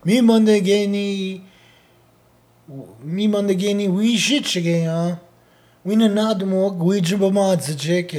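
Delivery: high, sustained storyteller chant in imitation; Uncle Lee. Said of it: "That's how he would say it".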